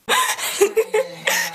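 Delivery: shrieking high laugh; giddy laugh. Real, not acted. Giddy laugh after surprise.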